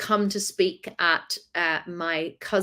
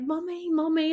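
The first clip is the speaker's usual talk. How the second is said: put-on higher, softer child voice; worried little kids; 8 semitones higher, 3 dB quieter than usual. Kids checking on crying mum, soft and worried. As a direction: gently ask like a small child. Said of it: "they were like".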